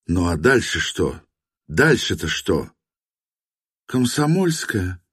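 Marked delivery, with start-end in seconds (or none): 3.9-5.0 lifeless woman's voice, higher. A narrator's reading. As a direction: say it dead, drained of life. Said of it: "безжизненным голосом предположила Настя". (безжизненным голосом)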